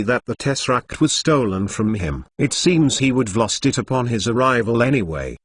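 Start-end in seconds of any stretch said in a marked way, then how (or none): none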